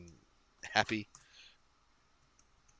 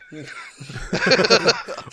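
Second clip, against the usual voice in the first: loud, high shrieking laugh; a real laugh that bursts out; high burst of laughter; 10 semitones higher, 10 dB louder than usual. Real, not acted. Cracking up at a brutal joke, a loud shrieking laugh.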